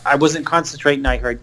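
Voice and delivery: normal talking voice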